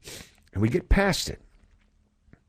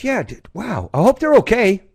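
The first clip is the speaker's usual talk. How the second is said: put-on much higher little boy's concern; kid voice; 14 semitones higher, 7 dB louder than usual. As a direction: say it like a sweet worried four-year-old. Young son voiced high.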